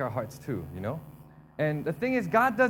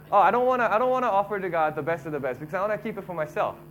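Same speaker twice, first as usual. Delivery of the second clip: mock whine, louder and fast; whiny excuse; 4 semitones higher, 7 dB louder, faster than usual. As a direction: imitate lazy worshippers grumbling out of it. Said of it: "They're saying".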